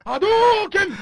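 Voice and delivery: loud, very high-pitched game attack yell; mock battle cry. Host playing at a video-game attack, mock battle cry. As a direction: shout it like launching a special move.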